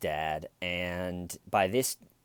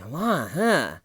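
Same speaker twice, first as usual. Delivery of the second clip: dismissive attitude; 5 semitones higher, 5 dB louder than usual. Retold or imitated. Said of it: "she has this attitude of".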